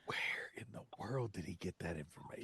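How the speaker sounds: hushed, breathless incredulous question; a sudden whispered aside of disbelief. Real, not acted.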